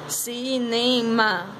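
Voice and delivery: cinema very slowly as "ci-ne-ma"; drawn-out "CI, NE, MA" for cinema; syllable by syllable. Shown as a demonstration. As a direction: stretch each syllable of cinema. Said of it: "Organize as sílabas".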